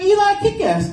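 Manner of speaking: his own voice pushed way up; high-pitched self impression. Comic retelling his school pickup, squeaky high self voice. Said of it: "It's like three octaves higher".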